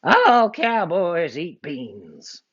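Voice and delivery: slow, drawled bad guy; outlaw voice. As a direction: lay down the rule.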